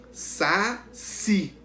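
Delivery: saci split slowly into sa and ci; stress on CI. Shown as a demonstration. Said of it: "Qual é que a gente pronunciou com mais força?".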